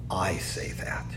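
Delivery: near-whispered confession; hushed admission. Real, not acted. Preacher admitting he says it too, hushed. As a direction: drop to a whisper, owning it.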